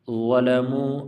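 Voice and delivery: ظلموا opens on a heavy, rounded th; thickened, drawn-out ظلموا; thick letter. Shown as a demonstration. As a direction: recite ظلموا slowly, first letter full-mouthed. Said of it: "پر موٹا پڑھتے ہیں".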